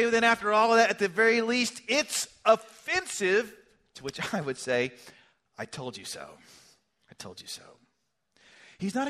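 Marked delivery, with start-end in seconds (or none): 0.0-3.5 loud, high indignant objection; mock objection. Preacher voicing an offended listener, loud indignant protest. 5.6-6.4 low, hushed, fading to a whisper; hushed told you so. Pretend. Preacher answering the objector, low hushed whisper. Preacher voices a smug reply. 7.1-7.6 whispered told you so. Pretend. Second take whispered.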